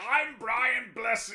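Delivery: high, drawn-out boisterous actor impression; Brian Blessed. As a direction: stretch it out like a hammy thespian announcing himself. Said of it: "boisterous personality".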